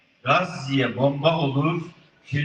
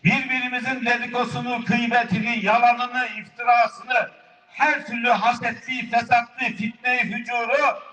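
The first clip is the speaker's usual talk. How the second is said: rapid list; 5 semitones higher than usual. Real, not acted.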